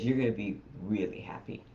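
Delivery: sly grin. Retold or imitated. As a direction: tease it like you're hiding good news. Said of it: "he gets this little grin on his face".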